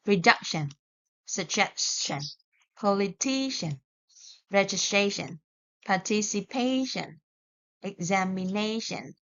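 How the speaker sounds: Reduction, suggestion, stress on the syllable before tion; teacher reads word list, clear stress; penultimate stress. Shown as a demonstration. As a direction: list each word hitting the second last syllable. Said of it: "stress on the second last syllable".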